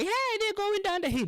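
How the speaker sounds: high falsetto calling out; third friend's voice. Retold or imitated. Third friend shouting down the hill in a high falsetto. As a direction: squeak it out like a clueless buddy.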